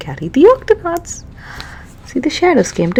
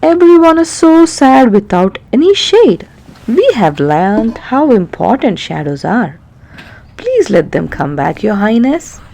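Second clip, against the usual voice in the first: pleading Peso; 2 semitones higher, 5 dB louder than usual. Acted. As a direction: beg the king to let them return. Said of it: "Peso pleaded".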